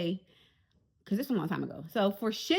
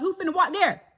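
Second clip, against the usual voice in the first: normal talking voice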